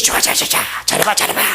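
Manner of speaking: rapid husky whispery egging-on; devil's cheering. Acted. Preacher mimics the devil cheering people on, lightly voiced devil's patter. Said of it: "그 사단의 소리예요".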